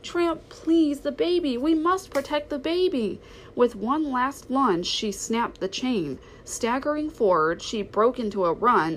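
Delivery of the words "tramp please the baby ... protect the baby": desperate pleading. A narrator's reading. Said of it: "cried Lady".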